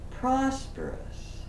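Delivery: ordinary talk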